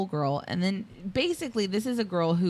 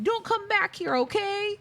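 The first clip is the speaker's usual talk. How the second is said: very high-pitched imitation; squeaky-high mock henchman; henchman's mock warning. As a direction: call out a silly parting warning, high up. Acting out henchman's parting warning.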